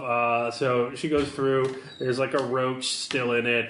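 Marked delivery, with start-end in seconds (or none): none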